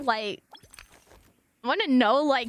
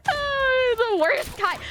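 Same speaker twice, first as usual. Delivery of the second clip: laughing yelp; 12 semitones higher, 4 dB louder, slower than usual. Real, not acted. Reacts to surprising fart joke.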